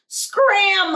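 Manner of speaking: high, loud shout to scare birds; holler. A narrator's reading. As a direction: yell them away. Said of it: "hollered Grandma".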